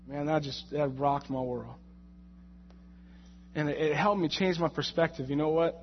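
normal talking voice

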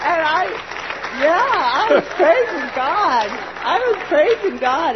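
exultant praise, voice lifted; joyful loud praise. Real, not acted. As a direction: rejoice out loud.

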